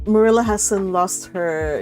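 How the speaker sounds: normal talking voice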